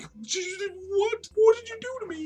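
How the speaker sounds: extremely high, strained squeak; absurdly high mock-horrified voice; agonized victim. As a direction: squeal it in comic agony. Flayed victim reacts.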